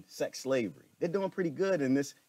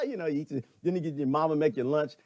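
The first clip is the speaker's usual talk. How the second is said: ordinary talk, nothing marked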